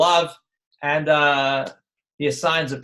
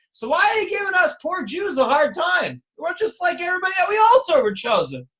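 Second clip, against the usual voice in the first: high, whiny mock complaint, pitched way up; mock protest; 12 semitones higher than usual. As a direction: play the aggrieved victim, half mocking.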